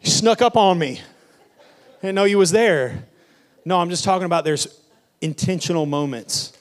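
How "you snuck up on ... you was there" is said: loud, high startled laugh; mock startled. Pastor feigning fright at kids barging in, loud and laughing. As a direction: jump as if caught off guard.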